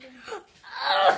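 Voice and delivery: crying out through sour candy; eating sour candy. Real, not acted. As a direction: sour pain, shouted with mouth full.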